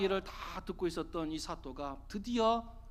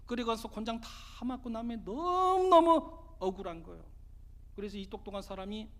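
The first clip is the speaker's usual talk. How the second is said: ordinary delivery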